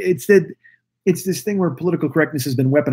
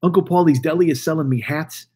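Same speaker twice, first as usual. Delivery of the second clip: ordinary talk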